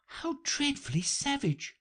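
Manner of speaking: put-on high-pitched girl's exclamation; exclaiming. A narrator's reading. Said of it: "exclaimed Alice".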